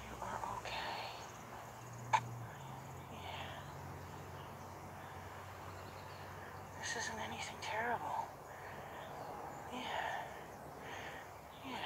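slow hushed reassurance; very slow calming whisper; soothing whisper. Real, not acted. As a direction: whisper it slowly to settle him. Soothing a scared horse up close.

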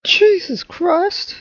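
high, slow startled cry; shocked gasp of Jesus Christ; startled exclamation. Real, not acted. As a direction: jolt up in pitch and draw it out. Startled by game.

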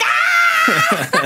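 high, loud squealing shriek with a laugh; mum's delighted scream. Retold or imitated. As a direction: shriek like a thrilled mum spotting a star. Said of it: "my mum ... was like".

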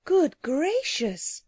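drawn-out, high exclamation of surprise; startled cry. A narrator's reading. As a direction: cry out in astonishment, slowly. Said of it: "cried Anthea".

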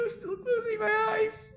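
high, slow whimpering cry; frightened whimper. Real, not acted. Streamer keeps eyes shut during the horror game, high whimper. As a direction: whine it out, eyes shut in fear.

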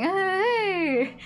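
high, drawn-out "hey"; sudden tipsy greeting. Retold or imitated. Tipsy bar customer suddenly turning talkative, exuberant.

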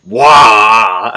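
loud mock battle roar; orc war cry. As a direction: roar it at your opponent. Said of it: "I need to do the whole friggin'".

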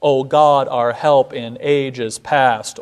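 slow, weak-strong beat through our help in ages past; measured hymn line; iambic recitation. Shown as a demonstration. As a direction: recite with stately iambic stress. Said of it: "written in iambic patterns".

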